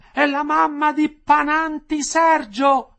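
high, drawn-out loud call; woman shouting out. A narrator's reading. Prisoner's wife calling for someone's mother, shouting. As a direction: stretch the name in a full-voiced yell. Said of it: "tornò a gridare la moglie".

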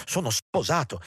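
high-pitched, mildly agitated; trembling protest. A narrator's reading. Hendrik tells Juliette he is married, agitated protest. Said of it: "mentre le labbra gli tremavano".

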